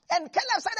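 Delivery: ordinary talk, nothing marked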